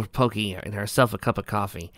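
usual speaking voice

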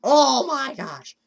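high, loud, drawn-out shout; exasperated yell. Real, not acted. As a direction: stretch it out as a yell. Failing the game.

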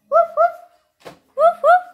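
put-on high, loud playful woof for a child; puppy barking. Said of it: "Puppy क्यों बोला?".

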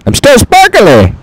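high chirpy boast; gleeful little shout; playful high-voiced brag; mock character voice. Voicing the character.

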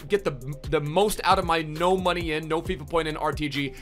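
normal talking voice

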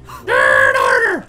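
long, loud drawn-out creature shriek; imitated loud yell. Player showing how pulled-up plants yell, imitated screech. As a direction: mimic a screaming plant, stretched out. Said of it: "they yell really loud, right?".

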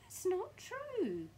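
high, loud, long-drawn protest; Mrs Twit crying out. Acted. Mrs Twit denying she is shorter, high drawn-out cry. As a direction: wail the denial slowly up high. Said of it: "cried Missus Twit".

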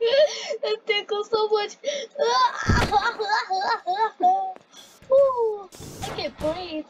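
breathless tickled laughing; being tickled. Real, not acted. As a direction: laugh till you can't breathe.

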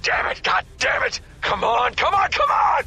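ordinary talk, nothing marked

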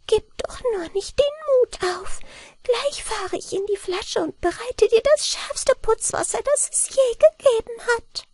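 very high, quick little-man voice; high eager gnome voice; eager mannikin. A narrator's reading. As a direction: rush in keen to help, tiny and high. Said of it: "sagte das Männlein eifrig".

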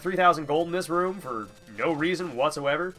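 ordinary talk

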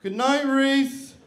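high, loud, drawn-out call; loud strained goodnight; nervous call out. Acted. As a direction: call across the room, slow and high. Calls nervously to the noisy roommate.

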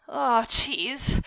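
a mild shout of dismay. A narrator's reading. Boy tasting his own blood, mildly shouting. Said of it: "I shouted".

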